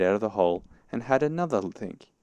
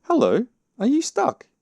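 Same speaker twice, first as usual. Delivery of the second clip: very high, loud rising question; surprised hail; 10 dB louder than usual. A narrator's reading. Rabbit finding Pooh in the doorway, surprised hail. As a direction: hail loudly, voice leaping up.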